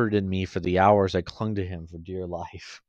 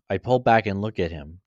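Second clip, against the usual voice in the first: plain reading voice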